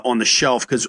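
usual speaking voice

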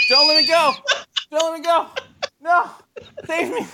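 hammed-up high cries for rescue; mock desperate wail, far above normal pitch; clinging poop pleading. Turd holding on for dear life, mock panicked pleading. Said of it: "it was, like, holding on".

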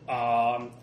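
normal talking voice

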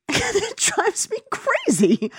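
high, slow, laugh-filled complaint; real exasperated laughter; laughing exasperation. Real, not acted. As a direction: laugh helplessly through the exasperation. Laughing exasperation at bad accents.

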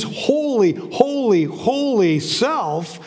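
first holy lifted high, then drawn out very slowly; awed repetition. Acted. Preacher dwelling on God's holy self, slow and awed. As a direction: linger on each holy in reverent awe.

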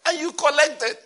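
high, loud incredulous accusation; scandalized, voice jumping up; mock disbelief. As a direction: pounce on it in comic outrage. Acts God's disbelief at the bribe.

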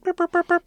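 high-pitched mouthed bloops; phone transfer beeps. Acted. Operator transferring the call, imitated high tones.

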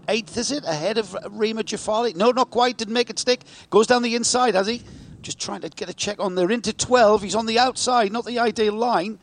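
rising excitement, calling the overtake live; quick live race call, pitch lifted; motorsport commentator. Real, not acted.

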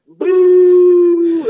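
long loud booing shout; crowd booing. Retold or imitated. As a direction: boo him off the stage. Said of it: "That's all you heard".

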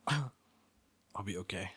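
ordinary talk, nothing marked

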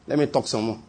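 low, soft murmured aside; quiet aside. Real, not acted. Preacher excusing his digression to the congregation, quiet aside. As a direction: say it softly and low, almost to yourself.

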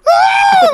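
very high loud scream; scream of pain. Retold or imitated. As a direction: shriek as hot coffee hits. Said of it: "the guy's like".

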